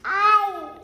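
loud play cries of pain; pretend ouch. Child voicing the hurt toy patient, loud pretend ouch. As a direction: yelp it like a hurt doll.

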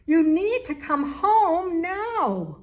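high, loud, slow frantic call. A narrator's reading. Worried mother calling her lost kitten, frantic shout. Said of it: "She kept meowing louder and louder".

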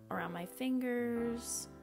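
ordinary delivery

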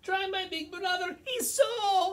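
very high, squeaky goat. Acted. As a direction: plead in a tiny squeak. Middle goat bargains.

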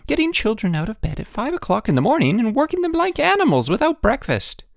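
high-pitched, scolding wife; indignant tirade. A narrator's reading. Wife berates headmaster for working children, indignant tirade. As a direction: rant at him, pitch way up.

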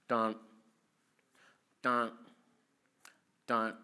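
evenly spaced "dun" imitating a tempo; spoken beat counting. Shown as a demonstration. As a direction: mark a slow beat with single syllables. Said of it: "and I had that tempo".

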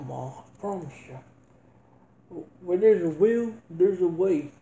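ordinary delivery

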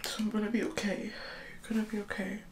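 soft, hushed promise to herself; self-reassuring whisper. Real, not acted. Comforting herself before the marathon, soft whisper. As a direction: whisper it to calm yourself. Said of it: "I don't know why I'm whispering".

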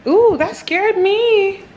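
high, loud, slow yelp of fright; real startled ooh. Real, not acted. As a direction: jump at a sudden scare. Startled by her own reflection.

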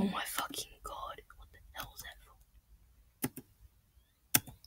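slow breathy whisper of disbelief; hushed whisper. Real, not acted. Gamer spotting something strange, whispering slowly.

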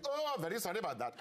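quick, high Welsh lilt; Welsh accent. Retold or imitated. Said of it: "I found myself going".

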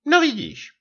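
very high satisfied peep; spokojené pípnutí. A narrator's reading. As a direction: chirp it as the vindicated wife. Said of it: "pípla se zadosti učiněním". (zadostiučiněné pípnutí manželky)

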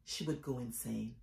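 very soft, low poetic aside; hushed recitation. Acted. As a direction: drop right down in pitch and volume. Poem reading drops to hush.

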